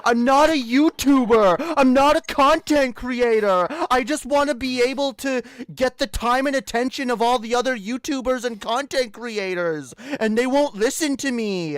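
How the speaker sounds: mocking whine, pushed loud; mocking Desolator. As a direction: sneer it like a self-pitying brat. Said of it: "I love how he uses this as a defense constantly".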